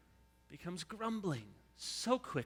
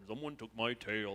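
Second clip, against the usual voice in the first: a light mopey donkey voice, low and soft; gloomy Eeyore; 5 semitones lower, 5 dB quieter than usual. Retold or imitated. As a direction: drag it out like a sad donkey. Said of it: "down and Eeyore".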